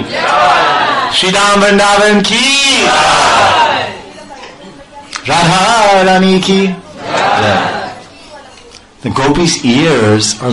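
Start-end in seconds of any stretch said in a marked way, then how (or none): 0.0-8.0 high shouted jai to the crowd; devotional jai call. Real, not acted. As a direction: rouse the crowd to answer jai. Call and response chant.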